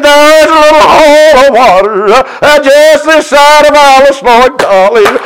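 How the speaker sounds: put-on young son's voice. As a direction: voice the little boy eagerly. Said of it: "Brother Mikey?".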